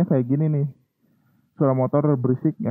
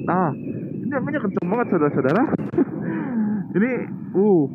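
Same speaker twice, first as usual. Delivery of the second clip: raised, high voice over roaring wind; shouting over wind; 6 semitones higher, faster than usual. Real, not acted. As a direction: call out over the wind while riding. Riding with loud wind noise.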